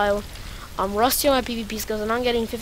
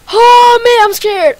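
high, loud scared outburst; scared cry; 11 semitones higher, 14 dB louder, slower than usual. Real, not acted. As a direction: cry out in genuine fear. Afraid in endgame.

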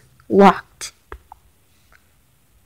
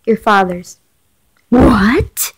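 shocked whisper; slower than usual. Real, not acted. Shocked reading of the reveal.

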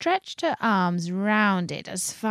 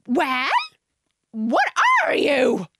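high, loud, drawn-out challenge; demanding; 7 semitones higher, 5 dB louder, slower than usual. A narrator's reading. Pigeon interrogating a faltering Alice, demanding. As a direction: squawk the demand loudly.

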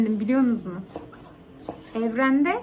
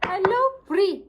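cheery greeting; 7 semitones higher than usual. Shown as a demonstration. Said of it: "Hello Bri dedim mi".

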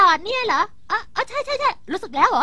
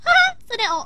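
startled; 11 semitones higher, 3 dB louder than usual. Acted. Startled seeing Suneo.

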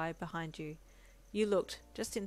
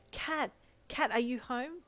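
raised voice calling into the apartment; 6 semitones higher, 4 dB louder, slower than usual. Acted. Deku coming home, calling his dog, raised call. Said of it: "Deku called out".

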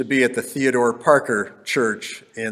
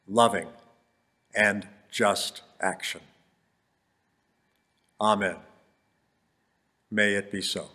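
guided meditation. Acted. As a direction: breathe with them, gently leading. Leads breathing meditation and prayer.

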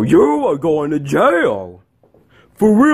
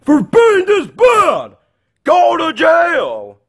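high, slow angry shout; acted furious order; 11 semitones higher, 3 dB louder, slower than usual. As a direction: shout the punishment, dragging each word. Angry sentencing of the chicken.

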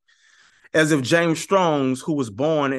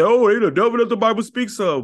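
mock dismissive quote; 8 semitones higher, 6 dB louder than usual. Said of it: "You brothers say".

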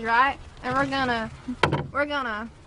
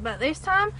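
ordinary talk

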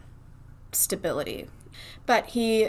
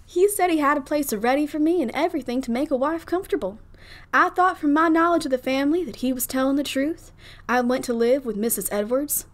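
lightly Southern belle reading; a hint of a Southern debutante; Southern debutante accent; 5 semitones higher, 3 dB louder than usual. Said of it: "in a Southern debutante accent".

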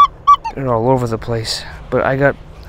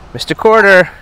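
higher, louder delighted greeting; excited greeting; 8 semitones higher, 7 dB louder than usual. Real, not acted. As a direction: hail the coin with glee. Greeting a coin fondly.

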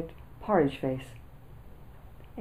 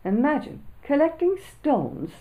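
higher and slow, hooting with scorn; a boy hooting at a silly idea; mocking hoot; 10 semitones higher, 3 dB louder than usual. A narrator's reading. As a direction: drag it out high like a jeering laugh. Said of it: "I hooted".

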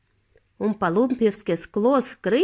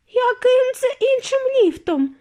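high, loud shriek; 5 dB louder than usual. A narrator's reading. Mrs TV shrieking at Wonka in alarm, high and loud. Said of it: "вирискнула пані Тіві".

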